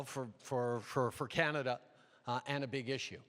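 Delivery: normal talking voice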